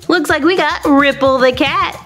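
ordinary talk

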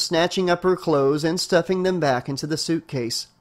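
usual speaking voice

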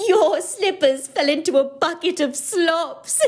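put-on high gleeful laughing girl. A narrator's reading. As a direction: giggle through the news. Olive gloating laughing.

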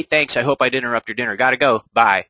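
usual speaking voice

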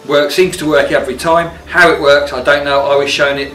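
usual speaking voice

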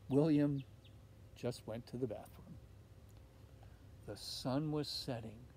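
ordinary delivery

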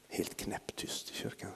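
whispered, slow; hushed whisper. Shown as a demonstration. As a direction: breathe it like a hushed church. Describing hushed church.

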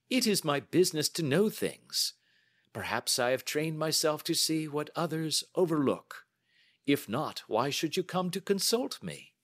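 high, lively, self-assured voice; amused, confident detective. Acted. Holmes explains his business to a client, amused and confident. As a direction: explain your skill with easy confidence.

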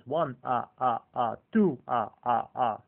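loud, hammed-up vampire count with gleeful "ah, ah, ah"; Count von Count counting. Fan mimics the counting vampire tallying goals, loud hammed-up glee. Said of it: "she can enjoy Count von Count".

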